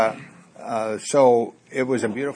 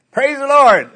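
high, loud cry of relief; exultant shout; 12 semitones higher, 9 dB louder, slower than usual. Acted. Rider saved at the edge of the precipice, shouting in relief. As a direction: whoop it out as the punchline.

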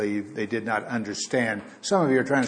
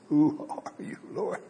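hushed, stunned "Who are you, Lord?"; awed, trembling question; 6 dB quieter than usual. Shown as a demonstration. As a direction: ask it in awe, not casually. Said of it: "It was probably more like".

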